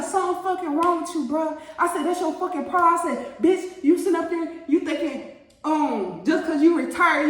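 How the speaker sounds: high-pitched mocking rant at him; retold cussing out. Telling off the retired man, high mocking rant. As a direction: tear into him, half laughing.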